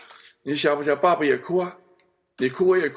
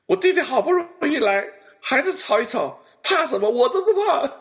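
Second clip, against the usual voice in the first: high, loud mock scolding of her husband; imitating sister scolding; 13 semitones higher, 4 dB louder than usual. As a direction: play the sister snapping at her husband in fun. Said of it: "结果我姐就跟我姐夫发个脾气".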